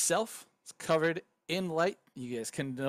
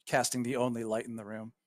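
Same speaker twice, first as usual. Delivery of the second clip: ordinary delivery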